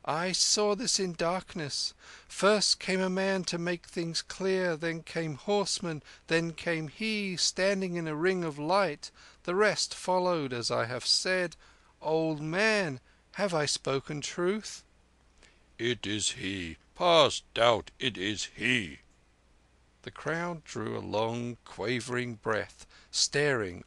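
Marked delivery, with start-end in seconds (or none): 0.0-14.8 high, chanting soothsayer voice; rapt fortune-teller sing-song. Acted. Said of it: "rapt sing-song of the wayside fortune-teller". 15.8-19.0 put-on an old veteran pressing for the detail; old soldier.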